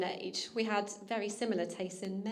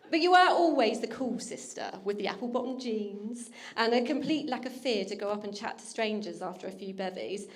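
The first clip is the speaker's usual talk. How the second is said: ordinary delivery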